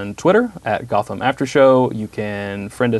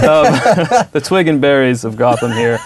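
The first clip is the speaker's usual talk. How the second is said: cracking up mid-sentence; laughing; 5 semitones higher, 5 dB louder than usual. Real, not acted. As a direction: talk through giggles. Host cracking up.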